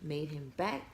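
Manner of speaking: ordinary talk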